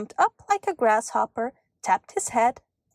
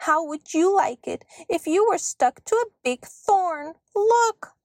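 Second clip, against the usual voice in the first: indignant little elf; 7 semitones higher than usual. A narrator's reading. Elf stuck on a thorn complains to girl, indignant. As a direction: complain shrilly, then exclaim at the end.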